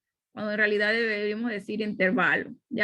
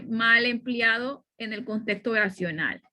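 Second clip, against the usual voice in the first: normal talking voice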